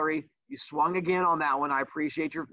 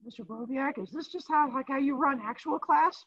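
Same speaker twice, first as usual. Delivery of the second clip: mock student; 9 semitones higher than usual. Teacher voices chat student.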